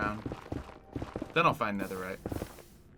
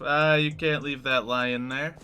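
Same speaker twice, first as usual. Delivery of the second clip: slow, louder "lion" slurred from "lying"; drawled accent punchline; 3 semitones higher, 8 dB louder, slower than usual. Shown as a demonstration. Streamer acting out the joke's punchline in a slow drawl. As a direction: stretch it out like a drawling bartender. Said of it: "say it with the right voice and accent".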